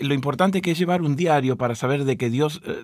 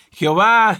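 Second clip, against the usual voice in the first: raised, louder mock battle cry; imitated cry; 6 semitones higher, 5 dB louder, slower than usual. Co-host imitates Joshua's men crying out, raised mock shout. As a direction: call out the name, higher and louder.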